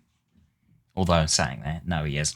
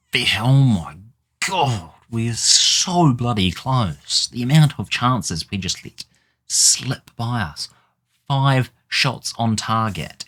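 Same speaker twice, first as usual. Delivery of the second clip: higher and louder frustrated rant; exasperated; 6 semitones higher, 5 dB louder than usual. Real, not acted. Manager ranting about his team's finishing, high and loud. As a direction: vent loudly at the wasted chances.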